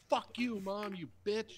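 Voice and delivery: high, loud mock kid outburst; angry child impression. Ten-year-old cursing out mom, angry high-pitched impression. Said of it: "It's like a ten-year-old kid".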